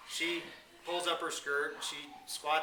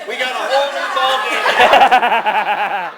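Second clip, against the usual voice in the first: laughing punchline; 6 semitones higher, 18 dB louder, much slower than usual. Real, not acted. Punchline delivered through laughter.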